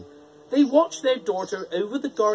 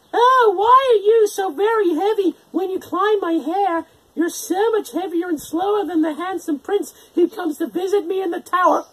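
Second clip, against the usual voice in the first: put-on high, louder girlish voice, speeding at the end; innocent Rapunzel; 12 semitones higher, 6 dB louder than usual. A narrator's reading. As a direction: ask it naively. Rapunzel innocently asking the witch.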